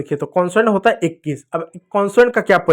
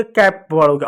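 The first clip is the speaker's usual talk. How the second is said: usual speaking voice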